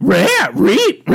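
high, loud cartoon dog voice; garbled talking-dog impression; Scooby. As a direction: yelp the words like a cartoon dog.

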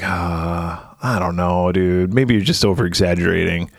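lightly voiced low, hesitant brush-off; a hint of a doubtful dude; Mark. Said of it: "And Mark's like".

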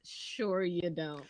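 low, slow, quiet disbelief; drawn-out, low mock agreement; skeptical retort. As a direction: answer softly and slowly, unconvinced. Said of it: "my man is like".

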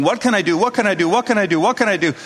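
rapid, looping mock panic; imitated panic. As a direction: repeat it frantically, imitating a panicked helper. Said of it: "he literally panicked and started running in a circle".

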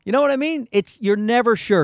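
normal talking voice

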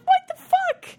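high, loud disbelieving yelp; shocked outburst. Retold or imitated. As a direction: blurt it out in sheer disbelief. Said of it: "I'm like".

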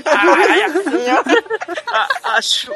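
cracking up mid-sentence; can't stop laughing. Real, not acted. Laughing through fake pain.

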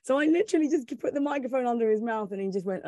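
ordinary talk, nothing marked

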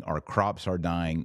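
usual speaking voice

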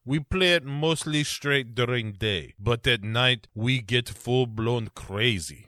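mock Russian villain, lightly put on; Russian boss accent. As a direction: play the Russian boss, hammed-up. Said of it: "the Russian accent".

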